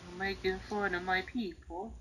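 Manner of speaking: soft, wounded line; hurt murmur. Acted. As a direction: say it softly, stung. Bowser hurt by the teasing.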